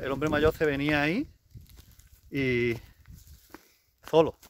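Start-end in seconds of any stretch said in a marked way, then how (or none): none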